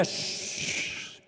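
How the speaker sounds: soft breathy hush; quiet the listener like a child; tender shushing; gentle shush. Acted. God hushing.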